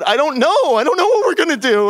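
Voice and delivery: high, loud, fast panic; freaking out. Retold or imitated. Narrator answering Dragan in panic, high, loud and rushed. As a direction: blurt it out in frantic alarm. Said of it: "And I start freaking out".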